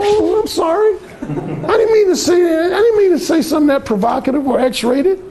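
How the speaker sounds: simpering sorry; hammed-up timid backpedal; backing down apologetically. Imitating a man backing down.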